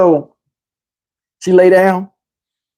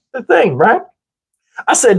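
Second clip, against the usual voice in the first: usual speaking voice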